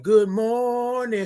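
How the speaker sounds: long sing-out hello; drawn-out greeting. Shown as a demonstration. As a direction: stretch MORNING out and hold it up. Repeats greeting in drawn-out signature style.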